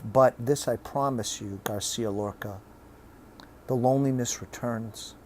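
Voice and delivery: ordinary delivery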